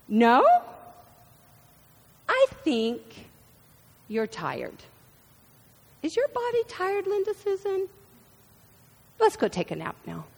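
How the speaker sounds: gently mock-questioning mom, quick at the end; a hint of a coaxing mother; mom's response. Mother coaxes Linda Susan to nap, gently coaxing. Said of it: "I got responses like".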